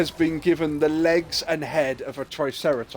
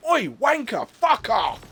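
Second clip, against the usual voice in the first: higher, snapped Oi, wanker insult; rough British yob; 6 semitones higher, slower than usual. Retold or imitated. A Brit telling someone off. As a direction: bark it like a rude English lad. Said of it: "What a British person would really do is go".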